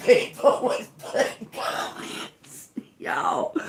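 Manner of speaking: breathless, voiceless laughing speech; slow, helpless real laughing. Real, not acted. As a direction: squeeze the words out through laughter. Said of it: "I cannot help but just sit here and laugh".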